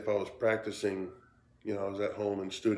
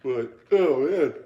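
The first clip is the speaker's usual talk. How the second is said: slow, louder words stretched through a yawn; yawning while talking; 5 semitones higher, 10 dB louder, slower than usual. Real, not acted. Cigar reviewer yawning at night, words stretched through a yawn. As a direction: talk through the yawn, slowly. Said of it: "Sorry for the yawning".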